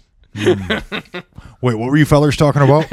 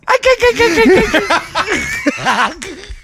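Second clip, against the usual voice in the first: high chattering dolphin cackle; dolphin-like clicking laugh. Pretend. Dolphin laugh after the joke.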